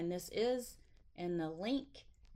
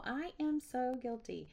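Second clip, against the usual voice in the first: ordinary talk, nothing marked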